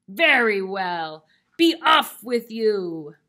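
very loud, slow troll dismissal. Acted. Troll lets the second goat go, bellowing. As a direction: bellow it, stretching the words. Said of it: "said the troll".

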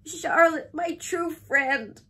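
high, loud, slow wail; wailing to a dying friend; anguished moan. A narrator's reading. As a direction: draw it out in grief. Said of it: "he moaned".

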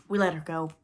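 pleased with himself, no alarm at all; complacent. A narrator's reading. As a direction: report it complacently to the captain. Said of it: "Smee answered complacently".

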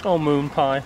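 ordinary talk, nothing marked

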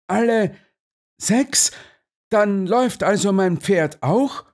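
high, startled questioning; great agitation. A narrator's reading. Colonel asks whether his horse runs, greatly agitated. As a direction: ask in agitated disbelief, pitch jumping up. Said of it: "sagte der Oberst in großer Erregung".

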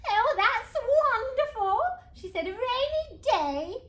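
high, loud, drawn-out praise; big sing-out admiration, slow and high; delighted mum. Acted. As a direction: gush over a child's painting. Mum praises the soggy picture.

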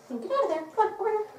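high-pitched coaxing call; calling the dog. Real, not acted. Trainer calling her dog back from where he strayed, high coaxing call.

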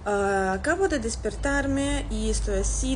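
ordinary talk

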